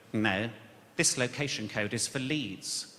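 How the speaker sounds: low, slow officious refusal, lightly voiced; jobsworth parking officer. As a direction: lightly play a petty official. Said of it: "walrus comes out with real jobs worths".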